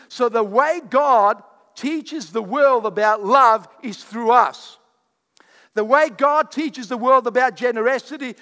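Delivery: loud, building, fervent sermon lines; excited preaching. Real, not acted. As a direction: let the excitement build line by line. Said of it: "I am getting excited here".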